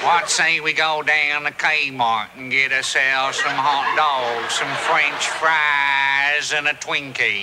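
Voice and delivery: low, slow, hammed-up American drawl; mock American accent. Mocks the Americans over hot dogs and Twinkies, exaggerated American accent. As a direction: caricature an American yokel, dragging the vowels out. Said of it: "You have an American accent".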